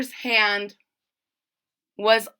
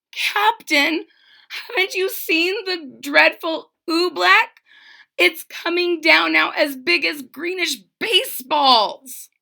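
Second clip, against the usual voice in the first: put-on anxious boy; 7 semitones higher than usual. Bartholomew urgently warning captain.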